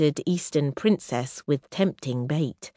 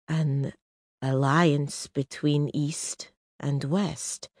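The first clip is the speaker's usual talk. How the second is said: slow, weighing each word; slowly; 4 dB quieter, slower than usual. Acted. Roshar grasping the marriage plan, slow. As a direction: draw it out thoughtfully. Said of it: "Roshar said slowly".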